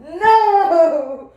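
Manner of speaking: high, loud laughing wail; laughing, anguished protest; mock despair. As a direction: cry out to the heavens. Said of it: "I was like".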